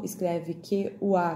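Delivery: ordinary talk, nothing marked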